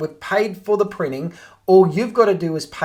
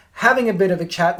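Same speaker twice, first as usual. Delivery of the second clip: ordinary talk, nothing marked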